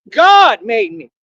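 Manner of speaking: slow, loud and forceful; booming slow proclamation; emphatic declaration. Real, not acted. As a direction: proclaim it like a preacher. Preaching rant, emphatic declaration.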